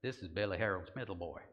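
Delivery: put-on drawn-in, low Texas accent. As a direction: try a folksy Texas introduction in a Texas accent. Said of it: "try this in my Texas accent".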